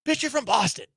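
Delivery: high-pitched, incredulous call-out; exasperated retort. Acted. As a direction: call out the faker in disbelief. Exasperated retort to the faked accent.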